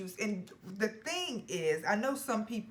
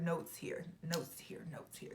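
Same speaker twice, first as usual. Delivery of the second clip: each repeat softer until whispered; fading to a whisper; 9 dB quieter than usual. Real, not acted. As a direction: let it die away into a whisper.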